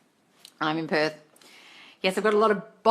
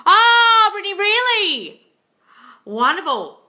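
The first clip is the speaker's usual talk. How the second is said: high, bright squeal of surprise; delighted surprise; 13 semitones higher, 9 dB louder, slower than usual. Real, not acted. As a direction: gasp it out, thrilled by the news. Reacting to a commenter's news.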